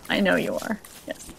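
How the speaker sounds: ordinary talk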